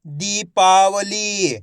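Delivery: दीपावली very slow, long vowels held; blended word demo. Shown as a demonstration. Teacher joining letters for pupils, दीपावली drawn out. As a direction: stretch each syllable of दीपावली. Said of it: "तो मिलके बन जाएगा".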